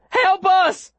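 high desperate yell; shouting for help. A narrator's reading. Boy carrying a wounded girl into town, desperate shout. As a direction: cry out high for anyone to hear.

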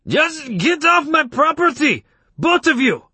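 high, slow enraged yell; shrill, angry bellowing; furious shout. A narrator's reading. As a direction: shout them off in a fury. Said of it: "and he shouted".

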